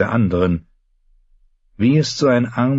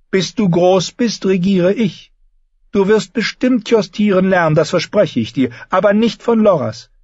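high, authoritative maternal voice; firm queen mother; 12 semitones higher, 3 dB louder than usual. A narrator's reading. Queen tells the boy she rules until he is grown, high and firm. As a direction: lay down the law to a small son.

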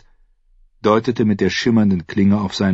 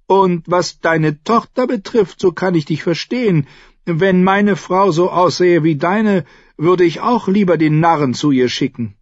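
Renly, taunting; 12 semitones higher, 5 dB louder than usual. A narrator's reading. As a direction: taunt the brother with false understanding. (langsam, hoch und höhnisch)

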